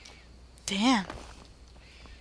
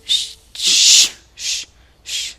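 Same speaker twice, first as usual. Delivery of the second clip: shushing; 6 dB louder, much slower than usual. Pretend. Nexus answers the narrator's warning, hushing. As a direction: shush them insistently. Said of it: "she was all like".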